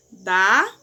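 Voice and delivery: "dá" said loud and punched, pitch dropping; strong stress on dá. Shown as a demonstration. Teacher showing pupils the strong word "dá" with heavy stress. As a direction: hit "dá" hard as a strong monosyllable. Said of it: "As fortes".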